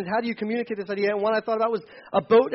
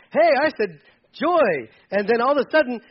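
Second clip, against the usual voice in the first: higher, louder mock-cheery wishful voice; mock naive optimism; 5 semitones higher, 5 dB louder than usual. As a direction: play the gullible believer, hammed up. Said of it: "Like, no".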